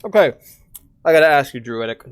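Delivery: ordinary talk, nothing marked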